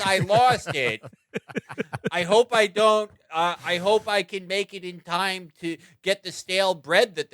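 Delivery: mock rabbi, high-pitched fretting; high, hammed-up kvetching rabbi; rabbi caricature. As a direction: fret in a high, put-on rabbi voice. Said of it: "And he's like".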